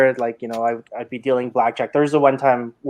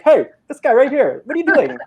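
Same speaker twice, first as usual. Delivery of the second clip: yelled call-out; 11 semitones higher than usual. Retold or imitated. New dealer calling out a cheater, high yell. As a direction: blurt it out like a panicked new dealer. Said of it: "don't yell it".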